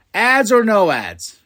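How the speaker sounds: annoyed viewer calling out Netflix; indignant question. Real, not acted. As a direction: press Netflix for an answer. Ranting at Netflix.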